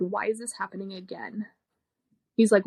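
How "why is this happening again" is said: emotional, quiet. Retold or imitated. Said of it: "don't see that man get emotional like that".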